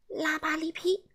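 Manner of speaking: far-off fairy voice chanting; magic spell words. Acted. Elf casting a spell on Mr Rude, eerie chant. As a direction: cast the spell as a little elf.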